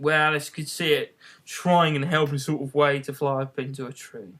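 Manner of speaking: ordinary delivery, nothing marked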